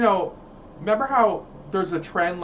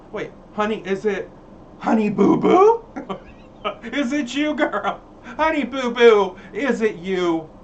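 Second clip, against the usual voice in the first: louder, higher teasing calls; 3 semitones higher, 5 dB louder than usual. Pretend. Calling out to Honey Boo Boo, teasing and loud. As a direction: holler at her playfully.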